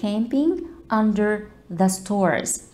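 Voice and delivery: slow, drawn-out teacher reading; slow model phrase. Shown as a demonstration. Teacher repeats the phrase slowly for students. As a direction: stretch each word for the class. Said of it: "I'm camping".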